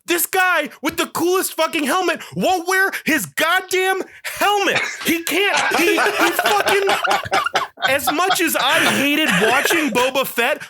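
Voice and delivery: high, heated ranting, half laughing; furious rant. Real, not acted. Podcast host ranting to cohosts, furious and high. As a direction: vent pure outrage at the show. Ranting about Boba Fett helmet.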